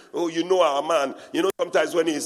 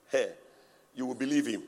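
ordinary talk, nothing marked

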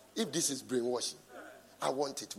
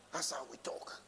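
whisper; 3 semitones lower, 11 dB quieter than usual. Acted. Preacher repeating his point to the congregation, whispered. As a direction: whisper "That's how we talk" under the breath.

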